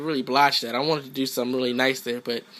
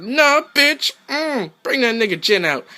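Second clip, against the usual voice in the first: higher, louder cocky retort; quoting himself; 9 semitones higher, 4 dB louder than usual. Retold or imitated. Gamer voicing his comeback taunt, high louder cocky retort. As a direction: talk trash with a high swagger. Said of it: "I was like".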